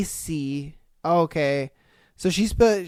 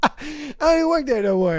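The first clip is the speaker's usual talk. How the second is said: laughing; 8 semitones higher than usual. Real, not acted.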